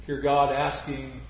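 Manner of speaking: usual speaking voice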